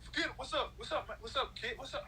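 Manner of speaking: high-pitched mock New York mobster accent; imitated New York wise guys. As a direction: greet like a movie goodfella, New York accent. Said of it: "that's how Jason and Kristen talk".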